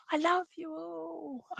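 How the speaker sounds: high, soft, drawn-out croon; sister's loving call. Retold or imitated. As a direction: stretch each word out softly, up high. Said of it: "but she says".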